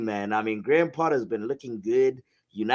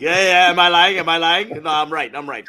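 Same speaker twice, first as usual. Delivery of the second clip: loud, pleased self-vindication; loud gloating; 5 semitones higher, 8 dB louder than usual. Real, not acted. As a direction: shout it, high and loud, triumphant. Gloating over league lead.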